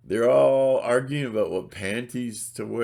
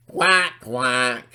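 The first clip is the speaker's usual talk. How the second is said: mock duck quacking; 3 semitones higher, 7 dB louder than usual. Mocking upset viewers.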